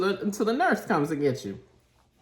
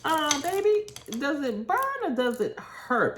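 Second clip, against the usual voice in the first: mock sweet concern; 10 semitones higher than usual. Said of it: "And I was like".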